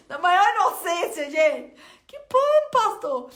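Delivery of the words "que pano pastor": high startled denial, louder; surprised. Retold or imitated. Answering the pastor on the phone, surprised and clueless. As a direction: caught off guard, clueless.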